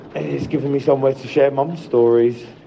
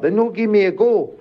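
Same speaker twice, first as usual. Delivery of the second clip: lightly voiced elderly mum, higher; imitating mum; 9 semitones higher than usual. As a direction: mimic mum's little complaint, pitched up. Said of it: "And she's like".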